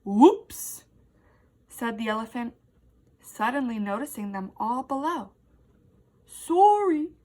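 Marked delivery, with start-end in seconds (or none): 0.0-0.8 startled whoops. A narrator's reading. Elephant startled. 6.3-7.1 elephant apology. A narrator's reading.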